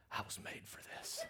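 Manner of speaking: breathy whisper through a laugh. Retold or imitated. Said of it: "and you say".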